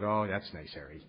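low, quiet deadpan thanks; dry sarcasm. Retold or imitated. Newcomer answering Harry's ominous warning, dryly sarcastic. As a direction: mutter it drily, unimpressed.

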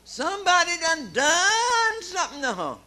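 put-on high, loud drunken yell; drunk shout. Drunk woman waking in bed, yelling at her mom.

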